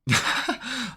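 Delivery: short high burst of laughter; a happy giggle at the chart; delighted laugh. Real, not acted. Trader pleased by the move, high laugh.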